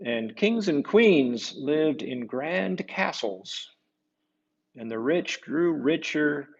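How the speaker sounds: slow, lilting read-aloud voice; storybook narration. A narrator's reading. Reading a saint's story to kids, lilting and slow. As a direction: read it like a bedtime tale to children.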